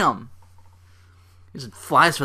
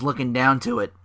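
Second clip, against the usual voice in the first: ordinary talk, nothing marked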